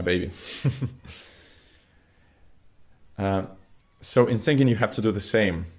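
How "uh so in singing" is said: normal talking voice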